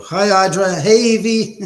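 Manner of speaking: loud, high shout-out; excited greeting. Real, not acted. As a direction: call out hello to arriving friends. Calling out to arriving viewers.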